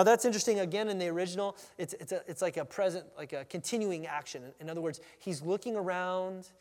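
ordinary delivery

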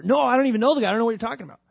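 loud, high, panicked denial. Acted. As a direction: shout it out in a panic. Peter denies Jesus.

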